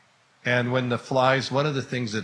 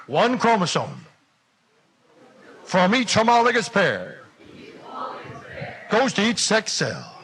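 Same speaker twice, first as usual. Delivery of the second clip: mock revival preacher; 5 semitones higher than usual. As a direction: rouse the congregation like a revival preacher. Preacher act for class.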